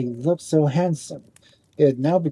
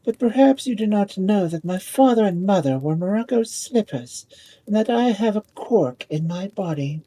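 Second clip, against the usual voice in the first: lightly voiced haughty ball, higher; a proud ball putting him in his place; 7 semitones higher than usual.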